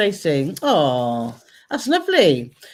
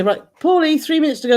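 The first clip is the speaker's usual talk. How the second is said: calling out; 6 semitones higher, 5 dB louder than usual. Retold or imitated. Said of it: "I say".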